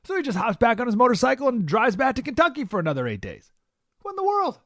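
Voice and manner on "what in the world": incredulous. Real, not acted. Reacts to the story.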